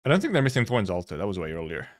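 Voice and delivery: ordinary delivery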